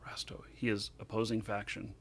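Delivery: hushed warning whisper. Real, not acted. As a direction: whisper it so nobody hears. Enemy ship spotted nearby.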